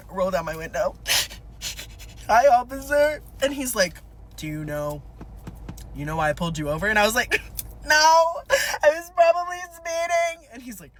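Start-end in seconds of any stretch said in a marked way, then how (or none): 2.3-3.2 higher, louder hammed-up weepy hello; mock tearful greeting. Sobbing driver greets the cop who pulled her over, mock tearful. 4.4-6.9 low, soft traffic cop voice; cop impression. Officer asks driver about the stop, cop impression. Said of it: "my cop impression". 7.6-10.5 high, wailing fake cry; mock hysterical sobbing. Sobbing driver confesses to the cop, mock crying. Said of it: "I've been hysterically sobbing".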